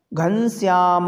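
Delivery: "Ghanshyam" drawn out at half speed; lingering final "a" on "Ghanshyam"; calling someone, prolonged. Shown as a demonstration. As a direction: call out "Ghanshyam" with the last vowel sustained. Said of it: "ऐसे".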